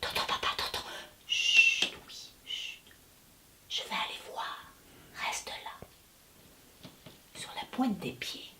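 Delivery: soft unvoiced whisper. The girl and her dad listening for the monster, whispering. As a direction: hush so the creature won't hear.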